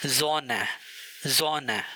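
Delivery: ज़ोन with a buzzing z at the start; the s in ज़ोन sounds as z. Shown as a demonstration. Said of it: "उसको जेड की तरह pronounce किया जाता है".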